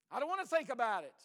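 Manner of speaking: imitating a congregant's loud protest; mock protest. Congregant's excuse voiced loudly.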